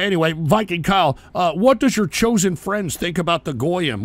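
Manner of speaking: normal talking voice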